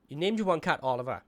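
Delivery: ordinary talk, nothing marked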